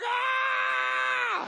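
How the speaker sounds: very high, sustained mock scream; hammed-up squeal of passenger panic; freaked-out scream. Passengers peer down the aisle, mock panicked scream. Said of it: "everyone is freaking out".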